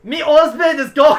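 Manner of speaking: loud, high, hammed-up Jamaican accent; mock-Jamaican accent. Shown as a demonstration. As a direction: belt out a joke Jamaican accent. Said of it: "Interesting accent".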